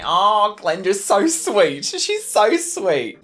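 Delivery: slow, high cooing over the cat. Real, not acted. Gushing slowly over their sweet cat Glenda, high and cooing.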